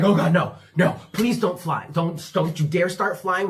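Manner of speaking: loud, mock-panicked pleading; alarmed, forceful outburst; panicked warning. Panicked warning to cockroach, retold.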